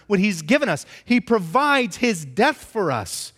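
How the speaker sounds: impassioned preaching. Acted. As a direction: preach it hard, rising to a climax. Preacher builds to full force.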